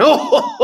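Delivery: loud high burst of laughter; booming laugh. Retold or imitated. Teacher laughing at the autograph reveal, loud and high. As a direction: laugh big at the surprise. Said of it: "And he starts laughing".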